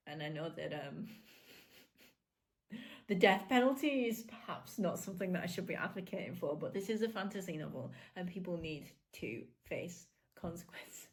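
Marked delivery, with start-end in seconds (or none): none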